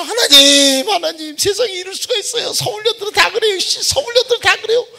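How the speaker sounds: high wailing sobbing plea; crying prayer. Retold or imitated. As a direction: sob it out to God. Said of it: "막 또 울면서". (엉엉 울면서 높게 부르짖는 기도)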